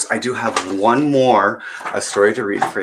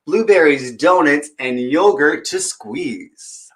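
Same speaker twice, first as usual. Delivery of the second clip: put-on bright, higher read-aloud voice for kids; storybook reading; 7 semitones higher, 3 dB louder than usual. Storyteller reading a picture book to children, lively. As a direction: read it like story time at the library.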